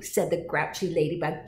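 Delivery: ordinary delivery, nothing marked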